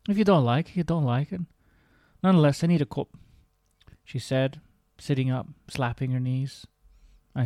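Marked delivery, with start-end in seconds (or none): 0.1-3.1 higher, louder matter-of-fact Scottish; Diana's Scottish accent. Acted. Diana deciding to make her own tea, in a brisk Scottish accent. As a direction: say it like a no-nonsense Scottish woman. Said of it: "my own version of a Scottish accent".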